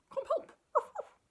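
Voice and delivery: very high, loud little dog voice; puppy voice. Acted. The dog calling the kitten, very high. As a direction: yap it like a small dog.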